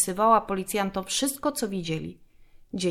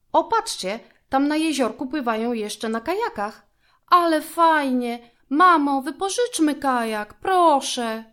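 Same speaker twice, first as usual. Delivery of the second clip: excited begging; 7 semitones higher, 5 dB louder than usual. A narrator's reading. Excited girl begging for kayak.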